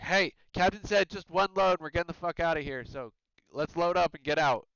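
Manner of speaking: high, loud hurried order; urgent shout. Acted. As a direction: rush them along, fast. Crewman urging others to hurry.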